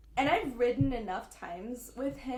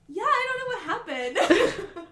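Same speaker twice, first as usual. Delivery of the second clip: fake casual; 8 semitones higher, 8 dB louder than usual. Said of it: "and I'm just like".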